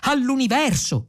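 high-pitched exclamation with a falling end; exclaimed toast. A narrator's reading. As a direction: proclaim it high. Second exclamation in a shared toast.

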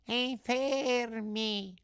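very high, long drawn-out wail; echoing cat. Acted. Cat echoes the begging fox, wailing. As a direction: parrot the word in a thin feline whine. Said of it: "ripeté il gatto".

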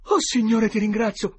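high, loud, relieved thanks; breathless relief. A narrator's reading. Tom thanks the Lord at the familiar bark, greatly relieved. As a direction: thank heaven as the terror lifts. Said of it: "bisbigliò Tom".

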